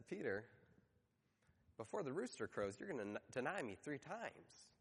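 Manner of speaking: low, soft, grave retelling; Jesus. Retold or imitated. Jesus tells Peter of the coming denial, softly grave. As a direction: deliver it gently and low, with pauses.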